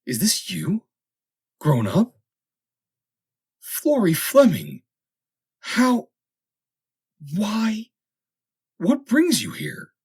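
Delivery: high and loud, each question bursting out in disbelief; can't believe his eyes; astonished. A narrator's reading. Denman shocked to find childhood friend aboard, astonished. Denman stunned to see Florrie.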